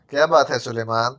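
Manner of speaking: ordinary delivery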